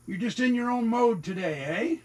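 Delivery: light high pet voice; pet talk. Real, not acted. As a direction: tease the cat gently, voice up high. Teasing his cat fondly.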